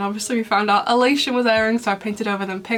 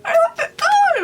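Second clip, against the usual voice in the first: a real burst of giddy glee; excited squeal; 5 dB louder than usual. Real, not acted. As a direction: squeal it far higher, fangirl delight. Fangirling over a cute scene.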